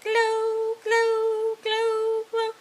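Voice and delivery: high singsong, drawn-out words; playful fairy voice. Acted. Fairies step on glowing puddles, high playful singsong. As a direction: imitate gleeful fairies in a high pitch.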